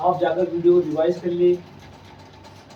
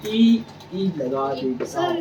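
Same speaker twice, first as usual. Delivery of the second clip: slow demo; 3 semitones lower, slower than usual. Shown as a demonstration. Teacher slowly showing students where ई is added, drawn out. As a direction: lay each word down slowly so they notice. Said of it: "अब changes समझना आप इनकी".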